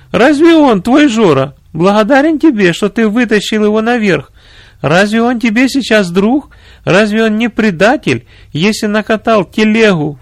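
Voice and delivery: высокий взволнованный голос жены генерала; отчитай мужа высоко, с напором; возмущённая жена. A narrator's reading. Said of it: "воскликнула его жена". (возмущённо, на высоких тонах)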